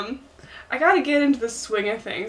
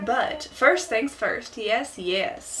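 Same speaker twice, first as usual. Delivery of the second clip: smooth Southern host reassuring newcomers; Tom Nook; 4 dB quieter, much slower than usual. Acted. Promising support to new islanders, smooth Southern accent. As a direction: deliver the sales patter in a Southern accent. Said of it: "The Southern accent is supposed".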